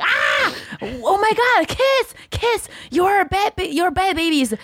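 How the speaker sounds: mock the mom shrieking over a mess; freaking-out grandma. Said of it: "she just freak out".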